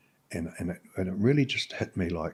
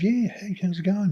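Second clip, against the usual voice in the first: bright greeting; 13 semitones higher, 5 dB louder than usual. Retold or imitated. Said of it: "and it's like".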